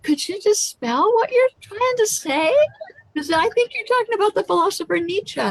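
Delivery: high, fast puzzled request to spell it; puzzled interviewer. Retold or imitated. Florida interviewer asks her guest to spell it, high and fast. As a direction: rush a baffled question, pitched high. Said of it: "the woman here in Florida said".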